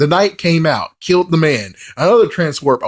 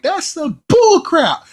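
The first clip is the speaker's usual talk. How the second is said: scoffing listener; 14 semitones higher, slower than usual. Retold or imitated. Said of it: "you're like".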